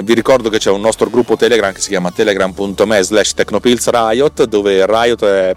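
ordinary talk, nothing marked

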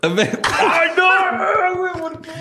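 carcajada aguda y apenada; risa aguda. Real, not acted. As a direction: riendo sin poder parar. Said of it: "Perdónenme". (riendo apenado)

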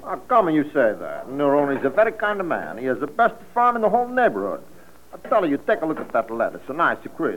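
put-on thick Italian immigrant accent, chatty; a vegetable seller with a heavy Italian accent. Neroni's Italian immigrant speech.

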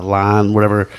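usual speaking voice

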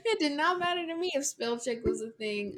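laughing through the words; giggling at herself; real laughter bubbling through. Real, not acted. Laughing at own spelling.

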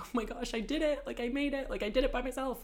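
high, giddy self-quote; squealing mock excitement; excited inner voice. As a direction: replay the thrill of landing alone. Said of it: "'cause I was like".

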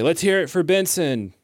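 high, projected call for applause; rally the crowd to cheer; hyped festival host; stage frontman. Acted. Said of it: "I'm gonna say".

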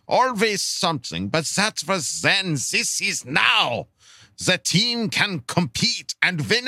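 put-on German-style accent. Lifelong Charger fan's question read aloud, hammed-up German-style accent. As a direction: declaim it in a heavy mock German accent, stretching key words.

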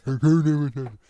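loud, blunt tough-guy [name]; Sylvester Stallone. Retold or imitated. Impressing the friend with a movie star, loud tough-guy voice. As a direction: bark [name] like an action hero. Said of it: "Sylvester Stallone saying".

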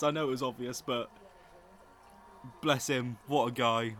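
ordinary delivery

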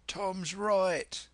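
high-pitched sailor's objection; pirate onlooker. Acted. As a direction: pipe up from the crew, pitched high.

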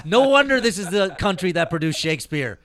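mock-solemn praise of the chant's poetry; mock reverence. Real, not acted. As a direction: gush sarcastically like a literary critic.

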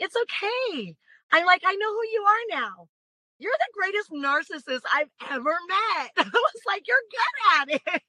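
high, bright, breezy retort; joyful confidence. Retold or imitated. Woman answering her ex at the car, light and confident. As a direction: brush him off happily and high. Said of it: "so full of joy and confidence".

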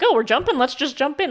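put-on high, loud, breezy quote of a trusting kid; mock carefree child. Quoting the blindly trusting child, eager and carefree. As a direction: toss it off like a kid leaping in. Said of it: "But, like".

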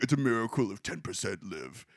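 low, soft statesman voice; hushed wartime-leader impression; Churchill. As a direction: deliver it grimly in a deep orator's register. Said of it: "obviously I'm doing the voice for Churchill".

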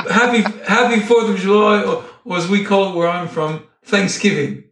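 hammed-up British airline pilot, cracking up at the end; bad British accent. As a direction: make a holiday announcement in badly faked British. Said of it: "that's my shitty British accent".

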